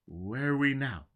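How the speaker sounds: loud, high urgent demand. A narrator's reading. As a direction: press for an answer. Foyle demands answers.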